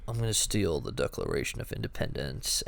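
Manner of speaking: low, quiet, bored monotone; monotone Nicolas Cage. Retold or imitated. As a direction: drone it like a checked-out actor. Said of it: "It was monotone Cage".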